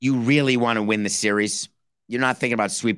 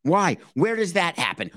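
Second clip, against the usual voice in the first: ordinary talk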